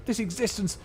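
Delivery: high, strained scorn at being alive; weary dustman raging against his existence; bitter dustman. Acted. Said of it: "He bares his teeth and his hands clench".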